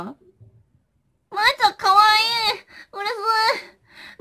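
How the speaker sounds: excited squeal. Real, not acted. Reading compliment, squealing.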